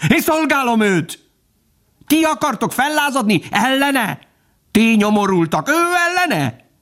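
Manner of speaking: very high, zealous tirade; fervent dog. A narrator's reading. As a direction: challenge them, then exalt the master in piercing pitch. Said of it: "A kutya remegett fellelkesültségében". (lelkesülten, éles kutyahangon)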